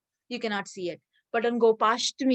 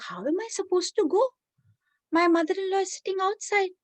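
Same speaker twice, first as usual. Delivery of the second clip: put-on worried young wife; 8 semitones higher, faster than usual. Radharani worried about her mother-in-law.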